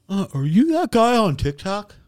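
tentative mock-fan question; hesitant fan. Kid approaching a recognized TikTok creator, hesitant fan imitation. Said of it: "gave me the classic fucking line".

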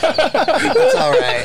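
high, very slow, lingering; drawn out high and soothing; commercial actor. Retold or imitated. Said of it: "they're about to kiss and they go".

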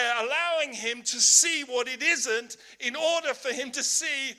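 loud, rising sermon climax; full-force preaching. Real, not acted. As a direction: preach it out hard to the congregation.

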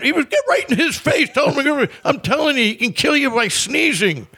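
very high, excitable imitation. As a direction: egg someone on in a sky-high mimicked voice. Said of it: "[name] was like".

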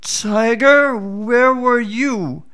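a crafty jackal pretending to be slow-witted; sly jackal. Jackal voiced in folk tale.

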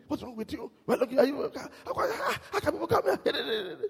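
high-pitched, exasperated mock rebuke; angry outburst. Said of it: "He was very angry".

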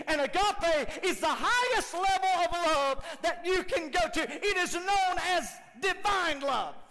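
high, loud sermon build; preaching at full force. Acted. Preacher building to agape. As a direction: lift the room to divine love.

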